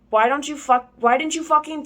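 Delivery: mock-indignant shouted question; mild narrated shout. A narrator's reading. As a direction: read out an angry shout, half-hearted. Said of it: "he shouted".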